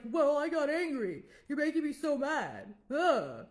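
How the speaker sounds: imitated loud angry outburst from the ex. Ex blaming girlfriend after throwing a frying pan, loud angry rant. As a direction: blow up at her, blaming her, ending in a loud groan.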